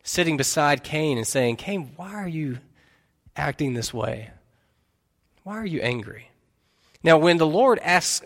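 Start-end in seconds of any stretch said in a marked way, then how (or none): 1.6-4.3 soft, tender fatherly question; hushed, caring appeal to a child; gentle father. Acted. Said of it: "like a father sitting on the side". 5.4-6.3 softly asked fatherly question; hushed, tender concern; gentle father. Acted. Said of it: "like a father sitting on the side".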